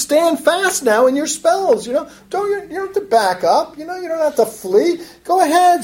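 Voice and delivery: high-pitched goading; sarcastic challenge, voice pitched well up; sarcastic taunt. Acted. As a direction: mockingly dare them to stand their ground. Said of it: "in a very sarcastic voice".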